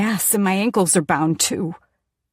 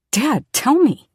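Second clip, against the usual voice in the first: pleading, raised and urgent; urgent plea; 6 semitones higher, 8 dB louder than usual. Acted. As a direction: beg him loudly to speak. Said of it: "I say".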